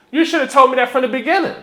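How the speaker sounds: worked-up customer confronting the mechanic; triggered customer. Retold or imitated. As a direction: rattle off an exasperated telling-off. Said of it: "I'm kinda triggered".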